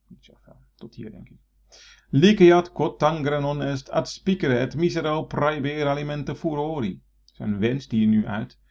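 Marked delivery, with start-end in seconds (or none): 2.1-7.0 verse recitation. Shown as a demonstration. Teacher reads next Latin verse.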